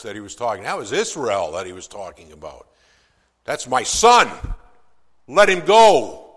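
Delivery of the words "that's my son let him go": loud, high and slow royal command; commanding demand. Retold or imitated. Moses demanding Pharaoh release Israel, loud slow command. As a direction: plant your feet and demand it. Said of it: "and he said".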